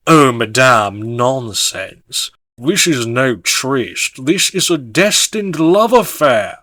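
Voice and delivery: slow, ringing proclamation; Brett Turlingshire. A narrator's reading. Dashing lover defending his destined affair, ringing proclamation. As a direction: proclaim it grandly, drawing out the words. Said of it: "Brett proclaims in his ringing voice".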